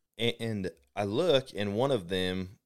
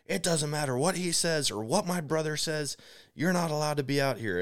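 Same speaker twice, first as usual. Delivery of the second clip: higher-pitched mock indignation; imitated angry woman; 8 semitones higher than usual. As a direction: mimic her objecting in a raised pitch. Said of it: "she's losing her mind".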